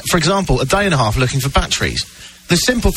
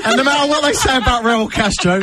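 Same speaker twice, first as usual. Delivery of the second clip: laughing while talking; 6 semitones higher than usual. Real, not acted. Mock rant at co-host.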